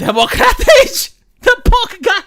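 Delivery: high, loud laughing speech; squeezing words out through real laughter; cracking up. Real, not acted. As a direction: burst out laughing mid-sentence. Cracks up reading a chat message.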